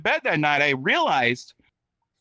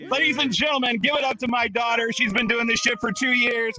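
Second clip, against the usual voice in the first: higher-pitched hype MC introduction; announcer; 6 semitones higher than usual. Real, not acted. Host introducing daughter to the crowd, hyped announcer. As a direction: bring the act on with raised, rousing pitch.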